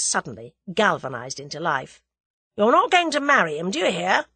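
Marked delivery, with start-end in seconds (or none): 2.6-4.3 raised, forceful order; domineering command, voice lifted; dictatorial. A narrator's reading. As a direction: lay down the law, loud and high. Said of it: "he said dictatorially".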